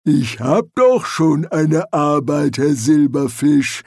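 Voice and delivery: lumbering troll, drawn-out words; unruffled troll. Acted. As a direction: answer slowly, completely unbothered. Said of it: "gab Detritus seelenruhig zurück".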